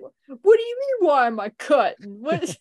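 loud mock Bronx accent. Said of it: "the Bronx accent".

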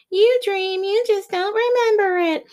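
high mocking imitation of others; mock know-it-all voice. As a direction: mimic the naysayers, way up high. Mocking people who insist.

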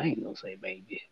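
whispered aside to himself; whispering. Acted. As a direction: drop to a breathy whisper. Repeats line, second take whispered.

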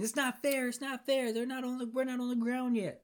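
high, whining rich-guy complaint; whiny Richard. Retold or imitated. Richard whining on the plane, high and petulant. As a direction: pout and whine like a spoiled brat. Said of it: "Richard over here saying".

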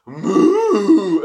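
high, loud, drawn-out moo; imitated cow moo. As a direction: answer with a big mock cow moo. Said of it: "he'd be like".

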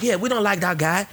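mock recruits. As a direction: play the recruits gushing over the good African. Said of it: "They were like".

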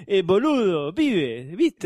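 put-on sing-song Argentine accent passed off as Mexican; hammed-up Argentine accent; botched Mexican accent. Host showing off his "Mexican", botched accent gone Argentine. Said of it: "acento mexicano".

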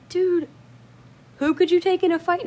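high, loud disbelieving question; mock incredulous. Imitating Brandon questioning brother's boast, incredulous. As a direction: challenge him in amazed disbelief.

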